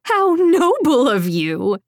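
high, scornful exclamation; lilting mock admiration; mocking amusement. A narrator's reading. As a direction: sneer it as if amused. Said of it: "Rufina laughed".

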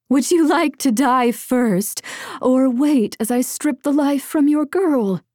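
lilting, taunting threat; taunting call. A narrator's reading. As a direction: call it out to hunted prey. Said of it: "Rufina called".

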